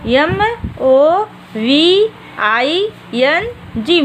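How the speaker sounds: each letter drawn out slowly; slow spelling. Shown as a demonstration. Teacher dictating a spelling to young students, slow and deliberate. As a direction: spell it out one letter at a time for the class. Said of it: "एक बार फिर से देख लीजिए बेटा".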